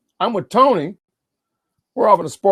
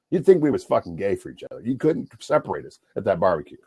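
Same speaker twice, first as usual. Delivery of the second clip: usual speaking voice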